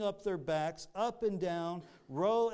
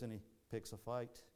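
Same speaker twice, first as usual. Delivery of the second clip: a quick, lower, softer attempt at a Scottish accent; 6 semitones lower, 9 dB quieter, faster than usual. Preacher jokingly retelling Braveheart in a Scottish accent. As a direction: rattle it off in a jokey mock Scottish accent. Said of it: "That's my Scottish accent".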